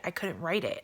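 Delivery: normal talking voice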